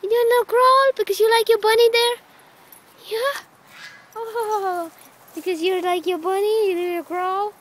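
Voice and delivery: high, loud sing-song cooing at a bunny; doting high-pitched pet voice. Real, not acted.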